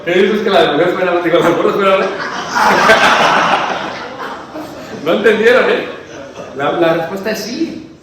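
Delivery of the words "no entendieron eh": loud laugh running into the words; laughing through the words. Real, not acted. Preacher laughing at the kids' confusion, loud and high.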